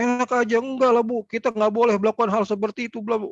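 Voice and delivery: high, indignant snapping back; mock defensive retort. Staff arguing back at angry mother, indignant. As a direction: argue back at the upset mother. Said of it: "Terus kita bilang".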